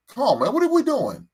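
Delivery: high, loud exasperated cry; fed up, voice jumping way up. Real, not acted. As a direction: throw up your hands in disbelief. Fed up with the player.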